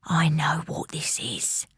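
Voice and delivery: breathy hushed whisper, slow. A narrator's reading. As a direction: mum barely breathing the words. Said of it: "whispered Mum".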